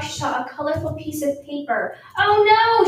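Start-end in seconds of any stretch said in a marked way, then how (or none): 2.2-2.9 higher, louder cry of alarm. A narrator's reading. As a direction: gasp it out in alarm. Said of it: "she cried".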